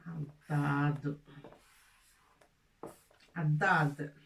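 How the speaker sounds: الضاد with a heavy emphatic D, mouth wide open; emphatic dad. Shown as a demonstration. Teacher modelling the letter for pupils, heavy emphatic dad. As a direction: repeat الضاد low and full from the back. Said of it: "Dad, c'est un D ... bien large".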